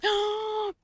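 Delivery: high, loud shocked gasp; sharp gasp. Real, not acted. Streamer hearing his teammate died, shocked gasp. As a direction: gasp as if struck by the news. Learns Bing Bong died.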